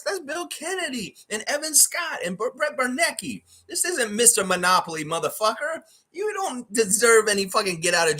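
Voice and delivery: high-pitched furious tirade at Lopez; furious rant. Real, not acted. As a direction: screech it in disbelief at him. Ranting at player's quote.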